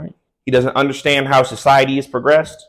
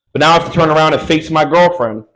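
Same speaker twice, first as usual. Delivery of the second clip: ordinary talk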